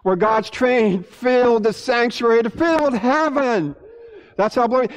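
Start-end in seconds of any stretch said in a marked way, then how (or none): none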